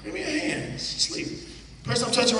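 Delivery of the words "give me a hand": normal talking voice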